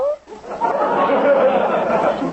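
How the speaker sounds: a high burst of real laughter; a high, hearty chuckle. Real, not acted. Father laughs at Kathy.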